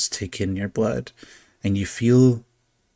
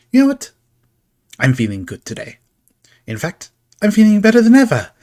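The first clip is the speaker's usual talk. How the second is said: high, loud burst of glee; gleeful showman; 12 semitones higher, 8 dB louder, faster than usual. Acted. Carnival figure boasting to the party, high gleeful burst. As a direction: crow with delight at feeling so good.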